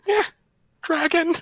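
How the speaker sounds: high, loud character yell; excited scream. Acted. Trelia screams at the dragon, high and loud. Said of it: "Trelia is gonna scream".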